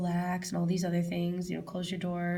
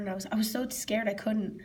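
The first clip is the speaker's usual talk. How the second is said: ordinary talk, nothing marked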